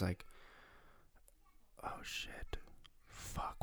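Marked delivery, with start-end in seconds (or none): 1.8-3.6 voiceless, far softer muttered curses; hushed inner-voice swearing; whispered panic. Retold or imitated. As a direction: whisper as if thinking in dread. Retold silent panic at blanking.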